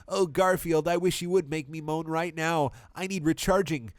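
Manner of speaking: high, sultry flirting; flirty Miranda. Acted. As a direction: purr it to tease him. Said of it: "Miranda flirted with sexiness".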